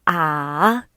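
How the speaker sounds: ah dipping right down, then rising; full third tone. Shown as a demonstration. Teacher showing third tone read fully, ah falling then rising. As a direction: give ah the whole low dip and lift. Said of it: "we read it fully".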